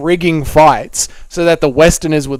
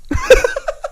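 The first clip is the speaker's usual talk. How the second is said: high-pitched burst of laughter. Real, not acted. Laughs after greeting chatter.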